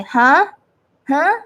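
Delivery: "Huh?" as a rising question; second tone, rising. Shown as a demonstration. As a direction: swing "Huh" up like asking what was meant. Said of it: "just like in English".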